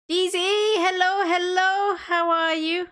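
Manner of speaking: high sing-song greeting. Acted.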